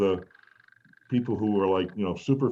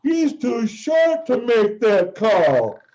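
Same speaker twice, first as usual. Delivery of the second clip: stadium yell; 13 semitones higher, 6 dB louder, much slower than usual. Retold or imitated. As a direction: bellow it slowly so the whole arena hears. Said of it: "and I yell".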